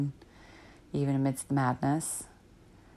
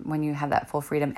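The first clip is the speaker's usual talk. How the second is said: normal talking voice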